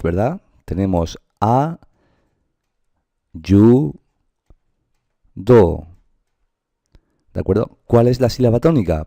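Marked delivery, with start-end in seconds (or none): 1.4-5.9 a-YU-do in three separate beats, long pauses between, "yu" and "do" loud; syllable by syllable. Shown as a demonstration. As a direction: break "a, yu, do" into slow, separate syllables. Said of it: "de tres golpes de voz".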